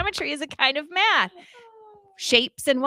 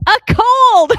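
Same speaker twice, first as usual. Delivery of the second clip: punchline; 10 semitones higher, 13 dB louder than usual. Real, not acted. Punchline of a riddle.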